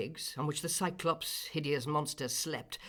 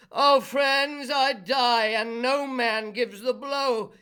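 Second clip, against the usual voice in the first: high, loud, agonised cry; anguished giant; 9 semitones higher, 10 dB louder, slower than usual. A narrator's reading. Blinded Polyphemus cries to his friends, anguished wail. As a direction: wail from the cave in pain.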